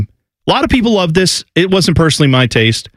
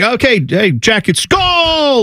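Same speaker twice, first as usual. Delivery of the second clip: lightly high half-hearted cheer; a toned-down announcer cheer; mild mock cheer; 8 semitones higher, much slower than usual. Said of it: "just be like".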